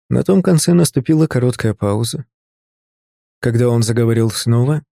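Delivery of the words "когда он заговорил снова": ordinary delivery, nothing marked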